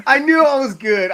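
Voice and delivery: high, loud laughing brag; laughing boast. Real, not acted. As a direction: crow it while laughing. Laughing self-praise.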